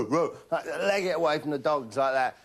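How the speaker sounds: usual speaking voice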